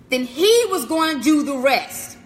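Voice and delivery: normal talking voice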